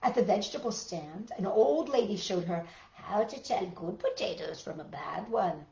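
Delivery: usual speaking voice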